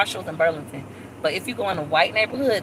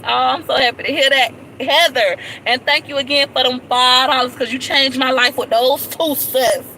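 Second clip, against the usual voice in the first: higher and louder, bubbling over with gratitude; excited thanks; 6 semitones higher, 6 dB louder than usual. Real, not acted. Vlogger thanking Heather for her gift, gushing excited thanks. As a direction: gush your thanks to a friend.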